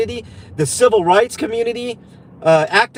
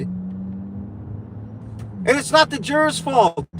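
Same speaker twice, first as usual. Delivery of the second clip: ordinary talk, nothing marked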